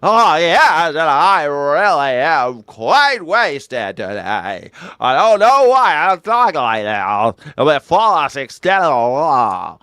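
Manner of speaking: loud, slow, slurred mid-Atlantic drawl; sloshed Hollywood grande dame, slow and loud; drunk Katharine Hepburn. Retold or imitated. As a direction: play a tipsy old film star. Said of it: "She sounds like a drunk Katharine Hepburn".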